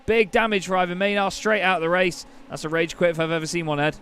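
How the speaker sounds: loud, excited race call; motorsport commentator. Acted. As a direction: call the race start at full pitch. Live race play-by-play at start.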